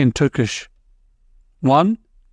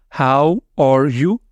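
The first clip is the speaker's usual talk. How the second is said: usual speaking voice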